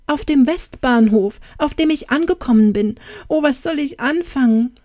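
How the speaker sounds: high, flustered and at a loss; distressed, not knowing where to turn; helpless dismay. A narrator's reading. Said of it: "Sie blickte ratlos zu ihm auf".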